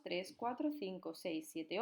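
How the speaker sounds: ordinary talk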